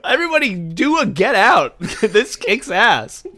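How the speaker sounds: high, loud words through laughter; cracking up mid-sentence; laughing. Real, not acted. Cracking up at own joke.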